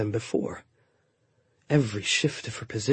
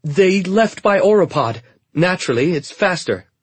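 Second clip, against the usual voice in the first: higher, louder quick-talking handmaiden; eager handmaiden; 7 semitones higher, 7 dB louder than usual. A narrator's reading. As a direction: cover the lie with eager chatter. Trina keeps lying.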